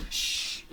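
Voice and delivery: long hissed shush. Acted. Dog shushes Rabbit while faking death, hissed shush. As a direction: hush a friend with a voiceless shh. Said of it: "Dog shushes Rabbit".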